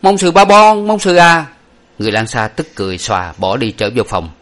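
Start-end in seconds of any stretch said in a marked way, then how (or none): 0.0-1.5 high, loud mocking pout; pouting taunt. A narrator's reading. Boy pouts and taunts the angry man, high and loud. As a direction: stick out your lip and taunt. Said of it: "đứng trề môi và nói".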